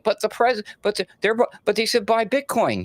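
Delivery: high, whining mock complaint; whiny investor. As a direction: whine like a burned little guy. Mocking whiny small investors.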